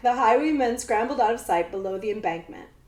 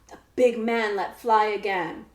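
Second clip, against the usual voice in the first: ordinary delivery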